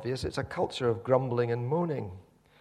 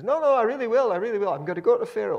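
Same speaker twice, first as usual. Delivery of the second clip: high, loud eager insistence; Moses insisting; 10 semitones higher, 8 dB louder, faster than usual. Retold or imitated. Moses insisting to the doubting Israelites, high and loud. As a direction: reassure them with raised enthusiasm.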